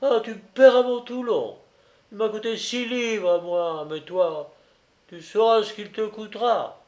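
high-pitched, aggrieved threats; indignant outcry. A narrator's reading. Susurrant threatens the man over his lost Toulon, indignant protest. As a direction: protest the loss and promise payback. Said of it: "s'écria Susurrant".

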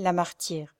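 usual speaking voice

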